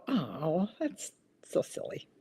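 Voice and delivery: breathy whispered oh, that's so silly; mock groan. Host voicing viewers groaning at the pun, whispered. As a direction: act out the audience's eye-roll. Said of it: "This is when you're gonna go".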